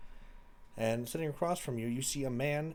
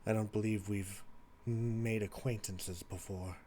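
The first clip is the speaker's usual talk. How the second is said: lightly voiced stranger, deliberate and courteous; polite mysterious visitor; 3 semitones lower, slower than usual. Voices man in teal suit.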